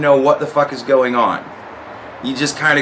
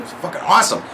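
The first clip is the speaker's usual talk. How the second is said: ordinary talk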